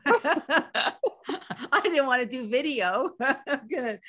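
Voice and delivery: laughing through the words. Real, not acted. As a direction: laugh while admitting it. Admits fear of video, laughing.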